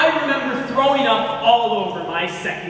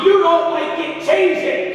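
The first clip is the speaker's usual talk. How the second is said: snaps it out, louder and higher; angry shout; 8 semitones higher, 5 dB louder than usual. Acted. Husband yelling back at his wife during the fight. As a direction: fire it back at her in anger. Heated marital argument.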